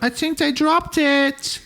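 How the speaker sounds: high, drawn-out silly voice; mocked onlooker. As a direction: pipe it up high and slow.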